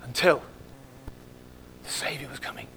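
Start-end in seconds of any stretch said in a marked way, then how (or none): 1.8-2.7 hushed whisper of awe. Real, not acted. Preacher whispering to the congregation. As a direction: drop to a whisper for the Savior.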